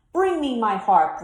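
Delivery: loud giant's roar for the harp. A narrator's reading. Giant ordering the cook to fetch the harp, roaring. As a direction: bellow the demand. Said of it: "roared the giant".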